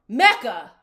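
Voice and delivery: yelling the name Mecca in frustration; a loud, fed-up cry of Mecca; exasperated shout. Real, not acted. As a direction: call him out loudly, fed up. Exasperated at a show character.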